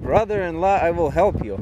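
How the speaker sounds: ordinary talk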